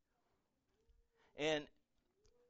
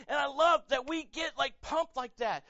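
usual speaking voice

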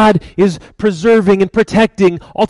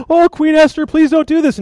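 higher-pitched begging; hammed-up anxious plea, pitched high; mock pleading; 7 semitones higher than usual. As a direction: act out a man begging for mercy. Haman begs queen for his life.

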